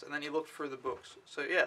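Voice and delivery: ordinary talk, nothing marked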